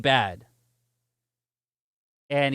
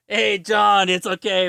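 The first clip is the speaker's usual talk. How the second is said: raised, louder voice hailing a colleague; imitated calling out; 5 semitones higher, 6 dB louder, slower than usual. Cop tells John about the nerd, mock calling out. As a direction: call across to your partner as the cop.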